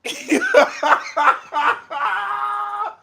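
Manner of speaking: shrieking burst of laughter; cracking up at the meow. Real, not acted.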